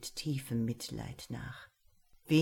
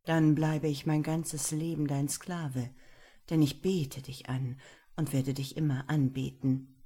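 ordinary delivery

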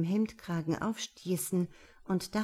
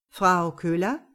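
raised voice, rising questioning end; calling for attention; 7 dB louder than usual. A narrator's reading. Banker calls his distracted client to attention, questioning. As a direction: prompt someone lost in thought.

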